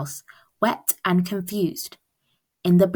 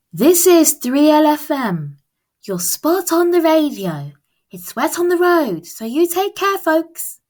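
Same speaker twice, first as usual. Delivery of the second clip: high, bright radio announcer patter; breezy DJ talking to listeners; 8 semitones higher, 4 dB louder than usual. Acted. Radio host warns drivers about the wet road, upbeat.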